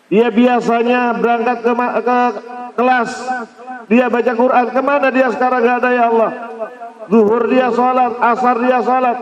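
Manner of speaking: high, pleading lament to God; lamenting earth. Acted. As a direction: plead like the earth missing its worshipper. Earth personified mourning the pious.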